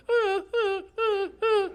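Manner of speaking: high-pitched imitation of a whimpering dog; short, high mock dog whines. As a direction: whimper like a pup begging to play. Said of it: "and she'll whimper".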